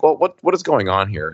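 normal talking voice

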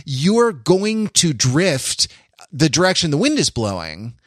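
normal talking voice

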